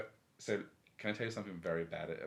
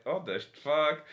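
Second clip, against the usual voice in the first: shocked; 7 semitones higher, 10 dB louder, slower than usual. Retold or imitated. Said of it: "And I was like".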